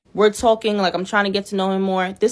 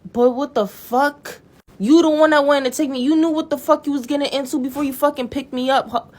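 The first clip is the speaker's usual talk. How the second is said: indignant rant; 6 semitones higher than usual. Real, not acted. Ranting about her date, indignant and raised. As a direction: vent the outrage at him.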